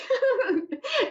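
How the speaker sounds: high, light giggle; amused laugh. Real, not acted. Laughing at herself for fumbling her words, amused giggle. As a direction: giggle at your own slip.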